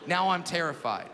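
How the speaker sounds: ordinary delivery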